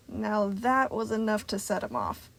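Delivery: ordinary delivery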